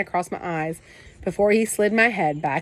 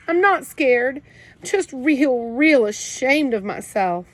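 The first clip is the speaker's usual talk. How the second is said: tearful; 6 semitones higher, 4 dB louder, slower than usual. A narrator's reading. Kenny crying to his brother.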